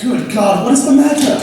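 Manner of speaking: loud, high alarmed question; alarmed exclamation. Acted. Gentleman seeing her distress, loud alarmed cry. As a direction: burst out in alarm.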